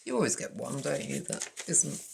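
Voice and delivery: ordinary delivery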